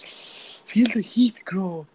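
slow, soft parody of a sleep ASMR; mock ASMR girl. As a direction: imitate the creepy ASMR girl soothing you. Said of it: "and it goes like".

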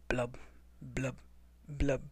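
low, soft bubbly blub; bubbling. Acted. Penguins haunting hunters, soft bubbling. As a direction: bubble the words out quietly. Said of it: "bubbled the bubble".

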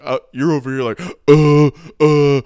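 loud, hammed-up baritone grunts mocking him; girlfriend's deep-voice mockery. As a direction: ham up a boyfriend impression with a big fake baritone. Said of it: "She just adds a lot of baritone to her voice".

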